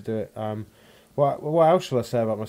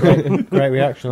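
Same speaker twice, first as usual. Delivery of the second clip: mock sarcastic; 4 semitones higher, 12 dB louder, slower than usual. Real, not acted. Joking about silent audience.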